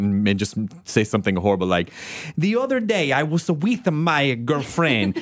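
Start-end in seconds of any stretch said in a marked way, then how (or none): 2.4-5.2 high, slow hammed-up Italian accent; mock Italian accent. Mocking a sweet Italian director, hammed-up Italian accent. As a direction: play the sweet Italian director saying something crude, Italian accent. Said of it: "take his adorable accent".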